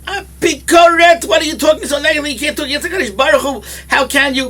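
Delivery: loud, high, furious yelling; hammed-up indignant shout; angry shout. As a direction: scream at him in outrage. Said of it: "he started screaming".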